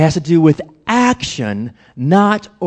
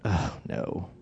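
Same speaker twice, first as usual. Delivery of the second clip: low, hushed mock dread; dismayed aside; 10 semitones lower, 15 dB quieter than usual. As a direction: mutter it very softly, dreading it. Retold dread at friend request.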